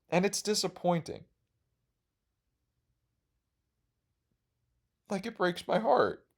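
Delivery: mock heartbroken. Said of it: "I didn't mean to get emotional".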